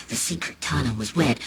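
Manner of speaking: ordinary delivery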